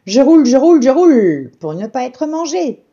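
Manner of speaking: a bouncy pancake refrain; pancake singsong. A narrator's reading. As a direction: chant it proudly while rolling. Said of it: "dit la crêpe".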